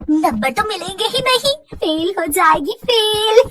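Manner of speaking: high, sing-out mad jeer; crazed taunting girl. Acted. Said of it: "भागते हुए भी वो यही बात कह रही थी". (पगली की तरह ऊँची आवाज़ में चिढ़ाते हुए)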